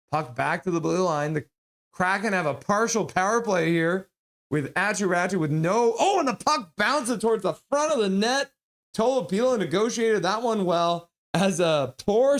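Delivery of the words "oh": normal talking voice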